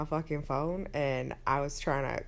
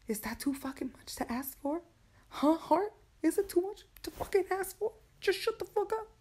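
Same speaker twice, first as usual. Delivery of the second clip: exasperated scolding; 13 semitones higher than usual. Acted. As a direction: yell at your own heartbeat to quit. Scolding her own heart.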